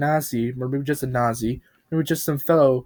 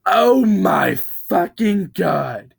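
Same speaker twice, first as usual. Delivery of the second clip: slow, high, loud wail of horror; horrified yelling; 7 semitones higher, 7 dB louder, much slower than usual. Acted. Truck sees Wendy's severed head, wailing in horror. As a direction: scream it out in shock. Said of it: "the truck was yelling".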